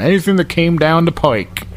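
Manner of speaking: mock the local saying with a grin; Glens Falls accent. Mocking the podcast host's slip in a Glens Falls accent. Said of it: "you just used the Glens Fallsism".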